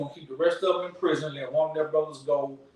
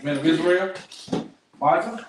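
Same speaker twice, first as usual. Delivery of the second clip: calling out names; 3 dB louder than usual. Real, not acted. Leader summons the men by name, calling out loud. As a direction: call each name across the room.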